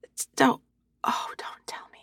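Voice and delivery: breathless whisper of dread. Acted. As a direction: hush it, almost to yourself. Embarrassed realization, hushed.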